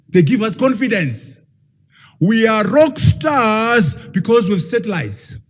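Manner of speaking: mock-bragging leader, half shouted, half sung; boastful China. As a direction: crow about the weapons like a rock star. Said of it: "you know what he said?".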